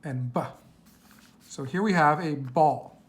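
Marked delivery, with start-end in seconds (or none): 0.3-0.4 "b" as a short "buh"; letter sound. Shown as a demonstration. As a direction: say "b" as its sound, not its name. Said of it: "our sounds are gonna be".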